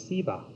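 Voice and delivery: "Siva" with an s sound in place of sh; Southern pronunciation. Shown as a demonstration. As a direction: say "Siva" the South Indian way, opening on a plain s. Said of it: "can be pronounced differently. In the South".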